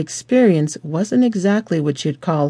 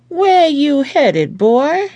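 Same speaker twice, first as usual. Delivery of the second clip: slow, drawn-out Southern cop, higher and louder; surly Southern twang; 10 semitones higher, 4 dB louder, much slower than usual. A narrator's reading. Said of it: "indignant and surly, his southern twang".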